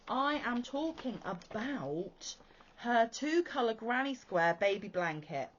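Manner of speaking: ordinary talk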